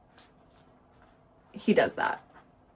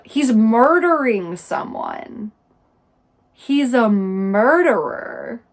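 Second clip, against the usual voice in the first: loud, slow, drawn-out stress on "murdering" and "murderer"; exasperated emphasis; 7 dB louder, slower than usual. Real, not acted. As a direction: hammer "murdering" and "murderer" in disbelief. Exasperated reaction to book's narrator.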